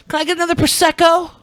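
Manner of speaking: high, prissy mock order; mock a fancy drink order; fancy boy. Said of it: "we can tell what Ben is".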